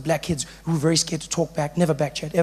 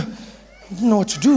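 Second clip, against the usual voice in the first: usual speaking voice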